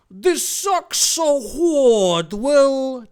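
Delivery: loud, high, drawn-out wail; Dave. Acted. Dave complains to Will on the dangerous route, wailing loudly. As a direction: wail it high and slow, stretching the vowels. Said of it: "okay, this is Dave".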